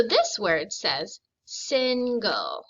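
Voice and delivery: slow, deliberate reading of single; slow and careful. Shown as a demonstration. Teacher to young readers, slowly enunciating the word. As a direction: spell it out slowly for the class. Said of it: "if you slow down and pronounce the word".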